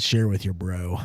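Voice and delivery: low, soft slogan read; mock slogan voice. Host reading a Coke label, low mock slogan. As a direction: drop it low and hushed like an ad tag.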